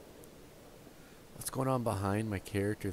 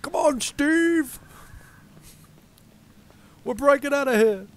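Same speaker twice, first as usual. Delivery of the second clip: very high, loud rallying yell; drawn-out, high-pitched mock-heroic shout; jailbreak shout; 13 dB louder, slower than usual. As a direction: holler to a pal mid-escape. Voices minions calling Steve to escape.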